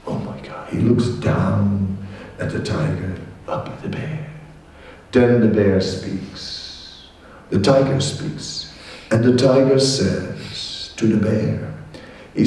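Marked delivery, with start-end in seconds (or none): none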